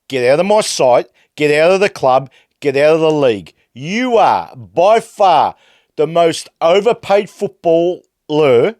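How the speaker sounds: real fury breaking through the calm; angry outburst. Real, not acted. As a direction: explode at him, dragging out by far. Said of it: "I can't be calm".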